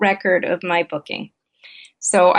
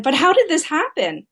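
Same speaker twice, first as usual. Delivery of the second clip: frustrated question, pitched up; irritated, protesting; 7 semitones higher than usual. Retold or imitated. Wife pressing husband about the missing ticket, frustrated. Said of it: "And so I again said".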